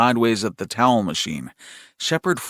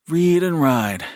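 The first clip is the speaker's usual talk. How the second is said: higher, held sing-song phrase; little brother's refrain; 6 semitones higher than usual. A narrator's reading. Brother replying to a concerned brother, higher sing-song. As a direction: answer with a fixed chant.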